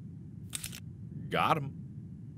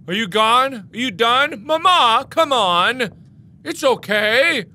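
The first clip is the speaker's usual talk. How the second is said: teasing call; 7 semitones higher, 5 dB louder than usual. Acted. Teasing monster calling after it.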